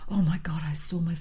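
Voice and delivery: whispered awe, breathless. Retold or imitated. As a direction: hush it like a secret. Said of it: "my husband's like".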